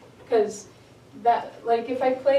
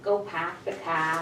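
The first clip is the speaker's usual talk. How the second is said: R-less Boston car; Boston accent; 4 semitones lower than usual. Shown as a demonstration. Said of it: "exaggerate the accent".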